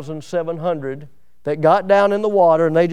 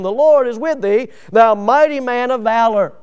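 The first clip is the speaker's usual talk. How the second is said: loud, raised proclamation; booming angel greeting; 5 semitones higher, 6 dB louder than usual. Acted. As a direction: announce it like a heavenly herald.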